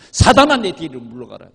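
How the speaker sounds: loud, low retold rebuke; stern rebuke. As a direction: imitate a forceful reprimand, hitting the opening loud. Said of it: "얼마나 책망을 받느냐".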